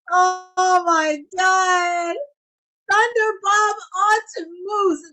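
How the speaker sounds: slow, stretched excited cry; excited shout. Real, not acted. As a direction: cry it out, stunned. Live reaction to a big move.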